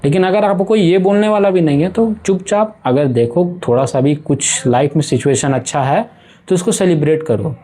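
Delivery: ordinary talk, nothing marked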